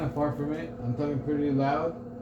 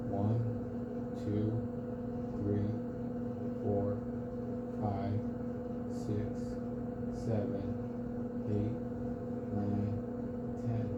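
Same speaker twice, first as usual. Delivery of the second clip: counting low; 3 semitones lower, 6 dB quieter, slower than usual. Shown as a demonstration. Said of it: "I'm gonna count to ten".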